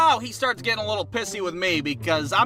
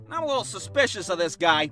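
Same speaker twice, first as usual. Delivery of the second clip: normal talking voice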